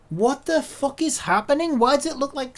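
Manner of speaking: high, loud, exasperated demand. Real, not acted. User faces broken software, loud exasperation. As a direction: demand an answer in disbelief. Software keeps breaking the model.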